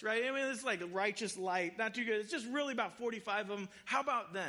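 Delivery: eager haggler, pitched high and loud; rushed, anxious negotiator pressing God; pleading Abraham. Retold or imitated. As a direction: push the bargain like a nervous, pushy Abraham.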